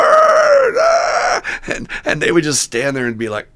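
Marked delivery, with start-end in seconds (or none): 0.0-1.4 very high, loud whooping scream out a car window; wild scream. Retold or imitated. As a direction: let out a joyful whoop. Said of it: "scream out the window".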